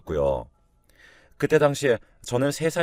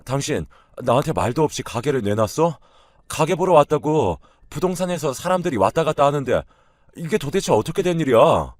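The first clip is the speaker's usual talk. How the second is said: loud, higher-pitched demand as an angry husband; angry confrontation; 5 semitones higher, 5 dB louder than usual. A narrator's reading. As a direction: confront her, voice climbing in outrage. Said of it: "제게 찾아와 따지기 시작했어요". (언성 높여 화내며 따지듯이)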